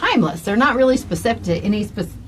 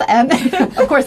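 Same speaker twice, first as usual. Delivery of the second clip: usual speaking voice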